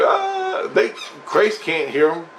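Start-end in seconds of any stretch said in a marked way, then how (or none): 0.0-0.7 scream. Retold or imitated. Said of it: "And he's like".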